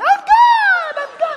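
very high, loud mocking yell; mock shout. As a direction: holler it like someone gone overboard. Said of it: "this person saying".

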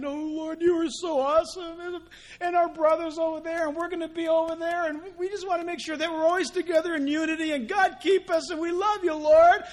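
high, eager, hammed-up praying; mock gushing prayer. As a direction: gush to the Lord like a happy worshipper.

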